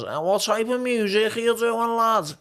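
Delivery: exaggerated high Scouse accent; thick local accent. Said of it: "with that accent".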